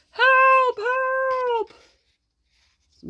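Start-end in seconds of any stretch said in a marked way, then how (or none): 0.1-1.7 much higher, hammed-up help; a panicked squeal for help; squeaky mock cry for help. The hero fleeing the monster, squealing high.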